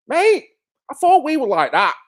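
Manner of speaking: high-pitched, put-on hurt; mock betrayal. Streamer to the mate who called him forced, mock betrayed. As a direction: plead high, as if let down.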